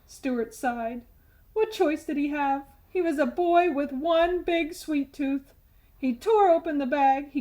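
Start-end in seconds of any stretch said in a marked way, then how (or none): none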